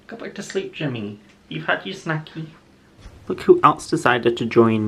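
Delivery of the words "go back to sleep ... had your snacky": soothing pet talk. Real, not acted.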